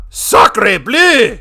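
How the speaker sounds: hammed-up outraged French cook; French chef. Exclaims in French.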